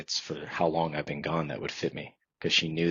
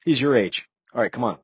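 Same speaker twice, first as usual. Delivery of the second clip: louder, higher, backslapping tone; hearty chief; 5 semitones higher, 7 dB louder than usual. Retold or imitated. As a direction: clap him on the back and say it big. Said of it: "And he's like".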